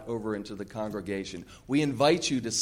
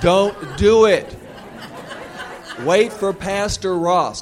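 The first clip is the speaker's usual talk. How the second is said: loud congregation call, laughing; 7 semitones higher, 9 dB louder, slower than usual. Shown as a demonstration. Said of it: "Raise your hand like this and say".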